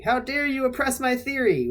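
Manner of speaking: high-pitched indignant protest; mock outrage. Crank scolding a doubter over his theory, mock outrage. Acting out Ray's argument with a challenger.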